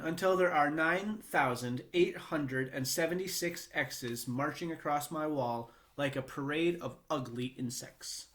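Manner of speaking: usual speaking voice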